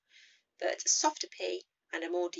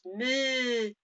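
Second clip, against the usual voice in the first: slow, higher French moo; French-accented moo; 6 semitones higher, 4 dB louder than usual. Shown as a demonstration. Teacher repeating the French moo slowly. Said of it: "moo with a French accent".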